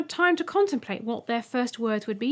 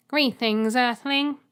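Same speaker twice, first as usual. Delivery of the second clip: louder formal robot greeting; robot egg greeting; 2 semitones higher, 7 dB louder, slower than usual. Acted. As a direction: announce it like a machine.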